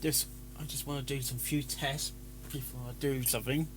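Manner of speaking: usual speaking voice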